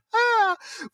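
squealing laugh, very high. Real, not acted. Host laughing at co-host's moon distance guess, very high.

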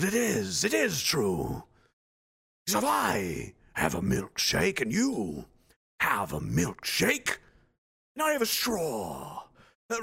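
very slow, drawn-out menacing gloat; hammed-up villain gloat. Gloating at Gerald in a slow hammed villain voice. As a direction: savor every word like a movie villain. Villain milkshake speech parody.

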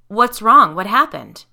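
husband alarmed at his wife's screams, shouting; alarmed questions. A narrator's reading. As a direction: demand to know what happened.

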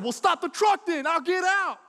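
loud, high-pitched angry shout; defiant yell. Retold or imitated. Kid yelling back at mom in the truck, defiant yell. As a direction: yell it like a big bad kid. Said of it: "and I yell this".

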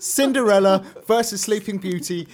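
loud hype announcer, voice booming; boxing ring announcer. Pretend. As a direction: sell the match like a ringside announcer. Hyping the poll vote.

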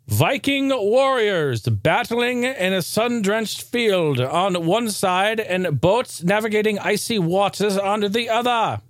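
slow dramatic trailer voice; trailer narration. Acted. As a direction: read it like an epic game trailer. Reads game trailer description.

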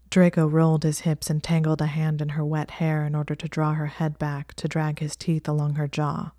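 plain reading voice

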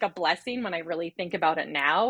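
ordinary talk, nothing marked

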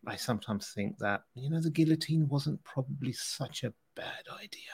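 hushed, near-whispered musing; confiding aside. Pretend. Host confiding a dark joke about his co-host, hushed aside. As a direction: drop to a whisper for a private aside.

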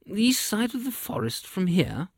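high, questioning royal voice; scheming king. A narrator's reading.

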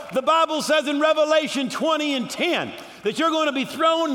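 high, loud defiant declaration; fiery preacher's proclamation, sustained high; full-force preaching. Acted. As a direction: shout down the devil triumphantly.

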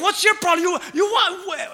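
high, loud shout slowing at the end; angry shout. Retold or imitated. Young woman shouting at the other driver, loud and high. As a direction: scream it back in a rage. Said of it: "And she's like".

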